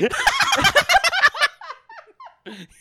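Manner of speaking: very high, shrieking cackle; maniacal laugh. Retold or imitated. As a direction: cackle like a madman. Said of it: "They laugh maniacally about this".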